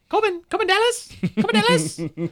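high-pitched squealing Chris Tucker voice; Chris Tucker impression. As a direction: shriek the name like Ruby Rhod. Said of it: "terrible Chris Tucker impression I just did".

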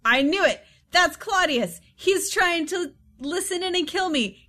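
high-pitched, agitated outburst; acted cry of discovery; alarmed Hamlet. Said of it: "he hears a noise behind the screen and he goes".

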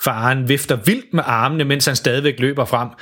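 ordinary delivery

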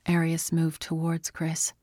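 plain reading voice

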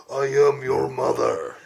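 lightly imitated demonic growl. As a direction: growl it slowly like a possessed mother. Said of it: "that growl".

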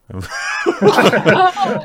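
big high laugh, breaking out; burst of laughter. Real, not acted. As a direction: laugh out loud at the slip. Amused at own slip.

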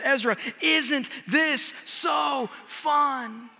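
very slow, drawn-out teasing question; teasing dad. Retold or imitated. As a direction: stretch the tease out slowly. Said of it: "I said".

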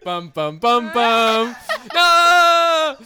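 hammed-up suspense stinger then a loud mock scream; dramatic horror sting. Revealing Jason driving the cab, hammed-up horror sting.

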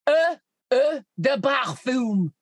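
slow, exaggerated French perfume pronunciation; mock French accent. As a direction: say it in hammed-up French.

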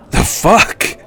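startled hunter; shocked outburst. A narrator's reading. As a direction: recoil in disbelief. Hunter recoiling.

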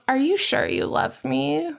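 mermaid. Acted.

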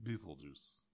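put-on low, quiet Russian accent. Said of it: "saying Beetlejuice in a Russian accent".

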